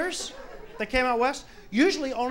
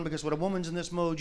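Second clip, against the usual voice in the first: ordinary talk, nothing marked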